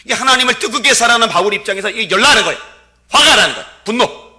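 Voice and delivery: loud, high, fired-up sermon; full-force preaching. Acted. Pastor rousing the congregation, loud and fervent. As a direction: preach it with burning zeal.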